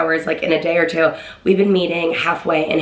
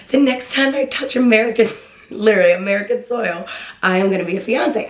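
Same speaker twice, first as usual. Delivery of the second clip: determined and excited; 3 semitones higher than usual. Retold or imitated. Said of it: "I was determined".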